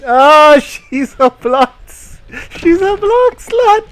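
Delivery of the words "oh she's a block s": normal talking voice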